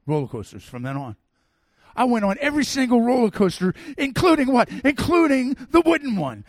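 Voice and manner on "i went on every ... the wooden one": loud, high-pitched triumph; excited boast. Real, not acted. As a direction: belt it out as a thrilled climax. Triumphant climax of coaster story.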